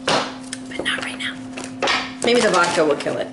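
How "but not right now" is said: a slow, voiceless whisper aside. Real, not acted. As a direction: whisper it, slowly, as a private joke. Aside about cough medicine.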